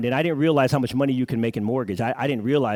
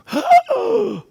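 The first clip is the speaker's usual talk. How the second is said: mock gasp; 6 dB louder than usual. Mock shock at broken window.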